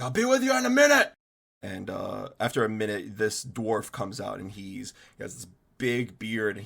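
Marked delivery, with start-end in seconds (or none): 0.0-1.1 called out loud and high, fast; shouted from the back. Acted. Dwarf smith shouting to customers from the forge. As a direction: yell it over the forge noise. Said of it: "he just shouts out".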